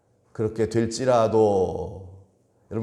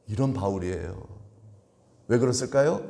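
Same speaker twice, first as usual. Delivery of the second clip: ordinary delivery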